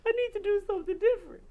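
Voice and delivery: loud, high, fast; insistent plea. Retold or imitated. Singer pressing John to let her change songs, urgent.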